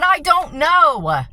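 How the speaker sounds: anguished cry of someone at the end of her rope; desperate outburst. Retold or imitated. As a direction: pour out despair to her dad. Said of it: "I said".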